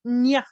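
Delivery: "nya" as one short gliding syllable; Ñ sound. Shown as a demonstration. Teacher showing learners the Ñ sound, short glide. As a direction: say "nya" with N running straight into Y. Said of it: "It sounds like".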